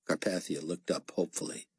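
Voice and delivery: ordinary delivery, nothing marked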